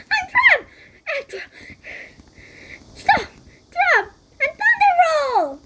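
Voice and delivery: very high, loud cries; trapped hero shouting. Acted. Trapped Kung Fu Chicken yelling moves, very high shouts. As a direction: yell in panic then fight back.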